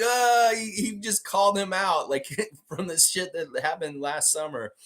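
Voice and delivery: high voice breaking into laughter; amused, laughing talk. Real, not acted. Host cracking up over the call-out, laughing. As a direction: talk through real laughter.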